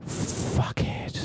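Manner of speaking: breathy under-the-breath jab; whispered insult. Retold or imitated. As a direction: hiss it as you pass. Insult muttered passing by.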